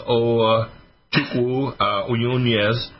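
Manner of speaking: put-on name said with a Nigerian accent, slow. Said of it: "So that is your Nigerian accent".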